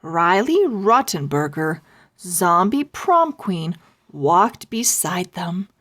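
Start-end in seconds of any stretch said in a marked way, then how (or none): none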